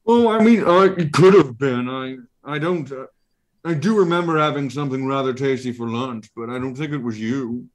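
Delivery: haughty creature brushing it off; Ralakor creature voice. Acted.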